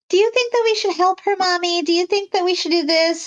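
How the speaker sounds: a retold daughter asking her mother, high and childlike; little girl voice. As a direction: ask Mommy like a small child. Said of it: "it's this kind".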